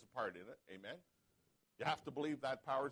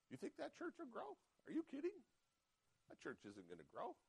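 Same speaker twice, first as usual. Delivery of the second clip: mocking taunt pitched much higher, then dropping soft; the enemy's voice; 12 semitones higher, 9 dB quieter than usual. Pastor voicing the enemy taunting him about his church, mocking. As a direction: sneer like the tempter mocking you. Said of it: "That's how he talks".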